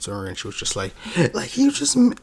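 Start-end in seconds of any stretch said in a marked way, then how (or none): 1.3-2.2 much higher and louder mock voice of the storyteller; imitating her. Reacting viewer mimics the storyteller, in a high loud mock voice. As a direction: squeak it out like an over-excited girl telling a ghost story.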